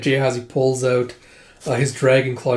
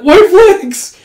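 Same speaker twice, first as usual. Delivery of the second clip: much higher, loud squeal; panicked shout; 12 dB louder than usual. Acted. Soldiers surrendering to the warriors, high panicked yell. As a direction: yell it like waving a flag.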